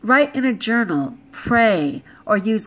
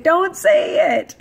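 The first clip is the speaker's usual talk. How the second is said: louder, slower, higher, every word pressed; emphatic warning; 8 semitones higher, 4 dB louder, slower than usual. Real, not acted. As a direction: lay down the rule firmly, slowly. Scolding the audience firmly.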